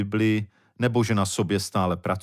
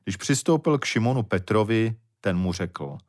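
normal talking voice

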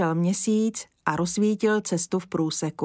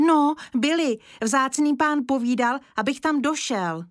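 lightly voiced boy, higher and eager; a small boy answering an adult, a bit higher; little boy voice; 7 semitones higher than usual. Boy Kája answers Mr Lesní.

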